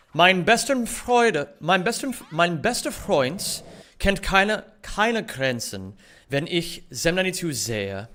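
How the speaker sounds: slow, halting "mein bester Freund" in learner's German; careful German. Shown as a demonstration. Streamer reading out typed German like "Freund", careful and halting. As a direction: feel out "keine Grenzen" slowly, restarting as you go. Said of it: "seriously try to say it well".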